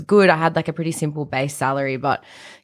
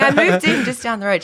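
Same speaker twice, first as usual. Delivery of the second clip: bright laughing retell, pitched up; laughing quote; 7 semitones higher than usual. Retold or imitated. Retelling meeting her friend, laughing throughout. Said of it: "I'm like".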